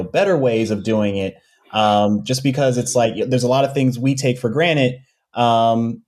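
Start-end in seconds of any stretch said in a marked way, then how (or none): none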